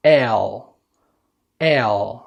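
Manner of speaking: dark English "L.", said in isolation; English letter L. Shown as a demonstration. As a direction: model "L." for repeating, tongue tip behind the teeth. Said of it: "وردد ورايا وقول".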